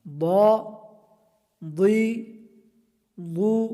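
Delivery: ضا loud and full-mouthed; heavy dad. Shown as a demonstration. Teacher repeats heavy ضا, full-mouthed. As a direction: hit ضا heavy and thick. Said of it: "مستعلیہ میں سے ہے".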